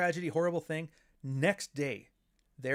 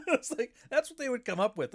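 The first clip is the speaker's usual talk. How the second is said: laughing; 11 semitones higher, 4 dB louder than usual. Real, not acted. Laughing at fake names.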